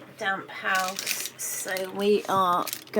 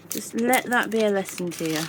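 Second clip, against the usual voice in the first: ordinary talk, nothing marked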